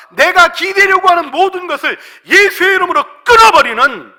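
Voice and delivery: high, shouted, building list; full-force preaching. Real, not acted. Preacher urging congregation to cut off worldly ties, full-force shouted preaching. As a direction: hammer out each worldly tie to be cut.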